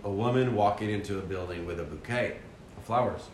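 ordinary talk

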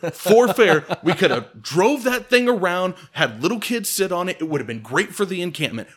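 high, loud acted rant; worked-up comic harangue; indignant I-told-you-so. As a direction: plead the absurd case at full volume. Acted argument pleading his case.

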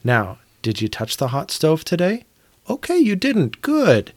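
put-on high sing-song voice checking on a little child; talking to a toddler. Parent quizzing a two-year-old about the stove, high sing-song. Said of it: "go to my two-year-old every day and say".

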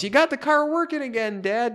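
put-on high, loud kid's yell; mock overacted kid yelling to his dad; high excited yell. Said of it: "Diet Randy even yells".

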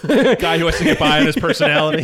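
talking through laughter; chuckling out the words; laughing. Real, not acted.

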